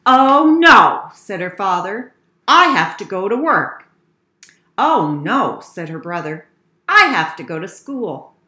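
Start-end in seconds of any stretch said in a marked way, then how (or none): 0.1-1.1 loud, alarmed outburst; father's acted loud alarm; dismayed. Father dismayed about being late. 2.5-3.9 loud, emphatic acted complaint; father's loud worry; dismayed. Father alarmed about work. 4.8-5.6 loud acted groan of dismay; brother's loud alarm. Brother dismayed about school. 6.9-8.4 loud, emphatic acted complaint; brother's loud worry; dismayed. Brother alarmed about school.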